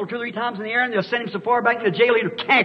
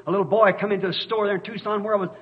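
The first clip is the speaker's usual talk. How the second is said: usual speaking voice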